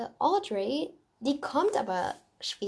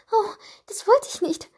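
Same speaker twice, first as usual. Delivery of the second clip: a much higher, louder guilty gasp of dismay; 11 semitones higher, 6 dB louder than usual. A narrator's reading. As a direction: blurt out the apology in shock. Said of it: "sagte Raven schuldbewusst".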